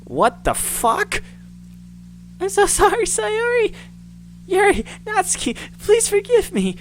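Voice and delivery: high, pleading, close to breaking; terrified girl. Acted. Monika in panic, pleading with her friends in a high, breaking voice.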